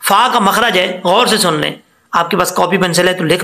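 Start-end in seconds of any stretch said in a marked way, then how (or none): none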